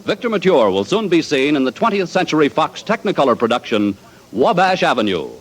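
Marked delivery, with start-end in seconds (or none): none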